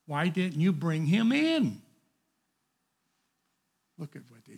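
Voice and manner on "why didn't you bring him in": higher and louder, a sharp demand; demanding question. Acted. Chief priests demanding an answer from the guards. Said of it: "who asked him".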